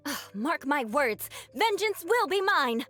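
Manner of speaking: theatrical vow of vengeance, pitch climbing high; indignant vow. Acted. Snubbed noble swearing revenge on a townsperson, theatrically indignant. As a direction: declaim it slowly, then rise in outrage.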